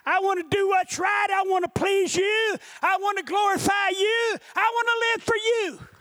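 high, insistent preacher's declaration; impassioned preached prayer, climbing in pitch; fervent vow to God. Retold or imitated. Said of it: "I told God".

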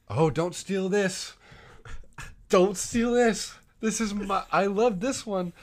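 slow, high mock pleading; sarcastic. Cousin pretending she wants to keep it, sarcastic. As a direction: overplay a fake attachment to the gift. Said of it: "obviously being sarcastic".

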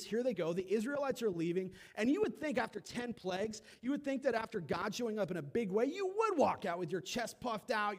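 ordinary talk

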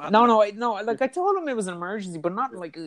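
quick, high flustered denial; protesting. Real, not acted. Host says he should have claimed an emergency, he protests, flustered and high. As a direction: rush to correct the host, voice up high.

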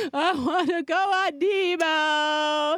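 put-on high, slow whiny kid voice; imitating a whining child the whole ride home; whining toddler. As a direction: drag it out like a cranky toddler.

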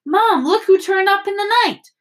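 high, loud put-on excitement; excited mock announcement. Fred acting out Ron greeting Mum, excitedly. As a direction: call it out high, as if bounding downstairs. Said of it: "you come bounding downstairs going".

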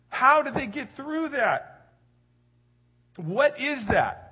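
high, loud mock amazement; amazed disbelief. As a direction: sound baffled at their calm.

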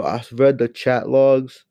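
usual speaking voice

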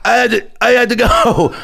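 high panicked excuse; panicked kid. Retold or imitated. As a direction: blurt it out sheepishly. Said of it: "And I was like".

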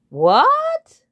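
very high, loud outraged cry; shocked what. Acted. Lead bird hearing the frog wants to sing, shocked. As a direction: recoil in disbelief.

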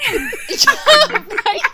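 real laughter at the joke; laughing yeah. Real, not acted. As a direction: let the laugh carry the word.

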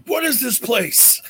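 lightly voiced wrestler promo; Hogan impression. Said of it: "come in as Hogan and say".

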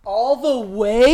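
real loud, rising astonishment; loud disbelief. Real, not acted. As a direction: stretch it out in amazed disbelief. Listener reacts to absurd phrase.

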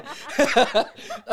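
unvoiced laughter; laughing under the breath; real laughter at the joke; breathy laugh. Real, not acted. Teller laughing.

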